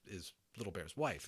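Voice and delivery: ordinary delivery, nothing marked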